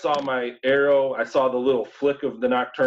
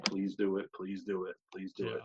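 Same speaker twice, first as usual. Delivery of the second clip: hushed pleading; 5 semitones lower, 12 dB quieter than usual. Retold or imitated. Pleading silently for the shot.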